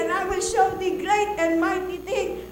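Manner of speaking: put-on mother reciting scripture slowly over her child; scripture recital. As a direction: declaim it like a prayer.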